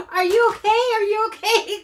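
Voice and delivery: high, loud question through laughter; imitating laughing concern. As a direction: ask if they're okay while cracking up. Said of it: "I'm laughing while I'm saying".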